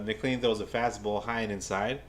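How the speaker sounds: ordinary delivery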